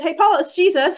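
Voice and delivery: excited greeting. Retold or imitated. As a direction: announce it like the best news. Jesus's words quoted with delight.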